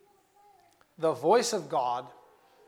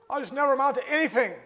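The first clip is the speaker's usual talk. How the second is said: quick, higher, louder cry of hopelessness; despairing outcry; 8 semitones higher, 6 dB louder, faster than usual. Acted. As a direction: throw it out like a desperate wail. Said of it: "We hear that saying".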